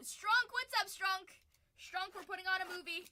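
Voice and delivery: high, loud hail to a viewer; calling out. Real, not acted. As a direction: call out an excited greeting.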